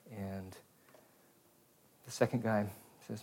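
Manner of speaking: normal talking voice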